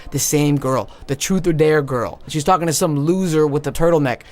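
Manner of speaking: ordinary talk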